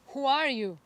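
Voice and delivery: loud, high call across to the mountain; yelled question. Retold or imitated. Curious boy shouting toward the mountain, loud call. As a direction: shout it out to a stranger far away. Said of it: "he yelled".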